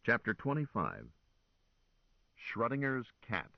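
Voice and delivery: ordinary delivery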